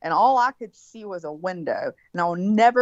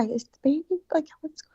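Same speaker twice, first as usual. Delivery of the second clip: high, hushed voice, fading softer; imitated whispering; 9 semitones higher, 6 dB quieter than usual. Aunt coming by to ask after the baby, mock whisper. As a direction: mimic a whispering visitor. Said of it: "they were just whispering".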